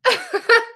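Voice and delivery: a big laugh, up very high. Real, not acted. Cracking up at a viewer's Kim K joke, high laugh. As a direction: let a real high laugh burst out.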